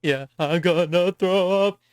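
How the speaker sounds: high, slow, drawn-out mock; a hammed-up slow queasy moan; mock queasy whine. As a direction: stretch it like a sick friend. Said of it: "mocking listener reaction".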